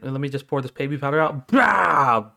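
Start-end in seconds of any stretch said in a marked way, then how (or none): 1.5-2.3 mock slap cry. Acting out a powder slap.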